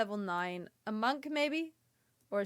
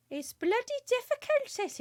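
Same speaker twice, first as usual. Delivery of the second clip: put-on a shaky try at a Welsh accent, higher, "bloody difficult" sung up; bad Welsh accent, pitched well higher; attempted Welsh accent; 11 semitones higher than usual. Streamer complaining about the boss, attempted Welsh accent. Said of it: "how to do a proper Welsh accent".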